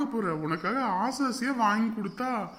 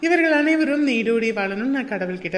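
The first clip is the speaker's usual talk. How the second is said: a louder announcer who slows right down on the opening hello; announcer read; 6 dB louder than usual. Acted. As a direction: greet the audience like a show host, loud and proud. Announcer greeting and birthday wishes.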